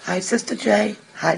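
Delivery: ordinary delivery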